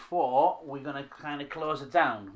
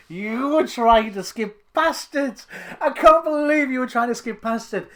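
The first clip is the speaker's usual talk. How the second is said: high, loud, indignant protest; mock outrage; 8 semitones higher, 7 dB louder than usual. Real, not acted. Host catches co-host trying to skip past the challenge, mock outrage. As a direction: protest loudly in an incredulous, raised pitch.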